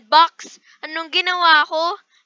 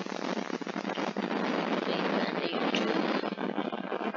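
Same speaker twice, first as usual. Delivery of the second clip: slow breathy whisper of Pink Panda YouTube; 10 semitones lower, 10 dB quieter, much slower than usual. Real, not acted. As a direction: whisper it slowly, drawn out.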